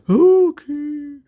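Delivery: high loud ghostly okay; meek ghost. Acted. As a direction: give in sheepishly. Ghost accepts tea.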